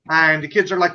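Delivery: ordinary talk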